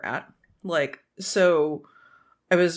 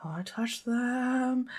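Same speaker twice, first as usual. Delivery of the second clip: soft, slow, yearning murmur; quietly longing to touch the dogs; longing hush; 3 semitones higher, 8 dB quieter, much slower than usual. Retold or imitated. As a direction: sigh it out like you're aching to pet them. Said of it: "And I was like".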